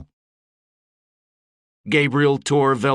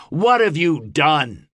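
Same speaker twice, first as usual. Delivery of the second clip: slow, higher demand; challenging oratory; 6 semitones higher, 4 dB louder than usual. A narrator's reading. Gabe challenging his audience, slow raised demand. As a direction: press the crowd for an answer.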